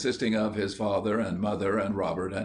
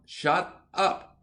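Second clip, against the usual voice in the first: sharp, higher desperate cry to silence him; desperate shout; 9 semitones higher than usual. A narrator's reading. Big brother silencing William, desperate and high. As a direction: cut the boy off in a panic. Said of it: "said Robert desperately".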